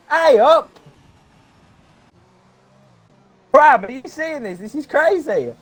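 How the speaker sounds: high, thrilled shouting; can't believe the result; excited. Real, not acted. Pole position decided, excited co-host.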